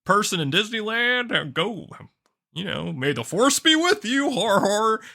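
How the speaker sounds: lightly hammed-up celebrity cameo with a fake laugh; cheesy theme-park greeter. Host mimicking celebrities on a cheap ride screen, mock cheery greeting. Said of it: "talking to me on it, like".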